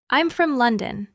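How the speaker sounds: ordinary talk, nothing marked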